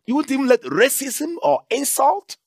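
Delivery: high, loud rising preacher's cry; a preacher hitting the peak of the sermon; preaching at full force. Real, not acted. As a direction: build to a shouting climax. Preaching climax.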